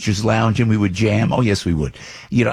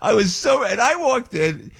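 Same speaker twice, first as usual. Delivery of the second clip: much higher, a delighted shout; excited shout. Real, not acted. Host on the radio recalls the best night, shouting with delight. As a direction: burst out with joy.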